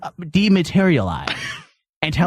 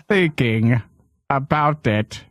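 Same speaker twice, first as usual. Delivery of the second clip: imitating the Shadow; 3 semitones lower, slower than usual. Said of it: "He talks like".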